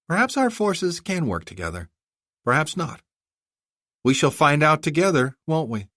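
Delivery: a hint of a regal young woman; young princess. Princess voiced by narrator.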